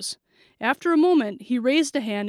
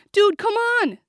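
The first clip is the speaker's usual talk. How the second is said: high, loud, urgent appeal; panicked protest; 10 semitones higher, 6 dB louder than usual. A narrator's reading. Friend appealing to the gunman, panicked. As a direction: protest loudly, pitched way up.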